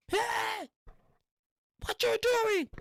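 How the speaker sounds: high falsetto announcement; idiot friend. Retold or imitated. Idiot friend giving away their spot in a high falsetto.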